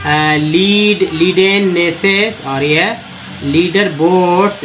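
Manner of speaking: slow, careful reading of each word. Shown as a demonstration. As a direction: spell the words out slowly for students. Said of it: "यहाँ देखिए". (धीमे-धीमे शब्द पढ़ते हुए)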